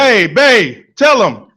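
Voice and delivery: loud call to babe. Real, not acted. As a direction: call out loud across the room. Calling out to wife.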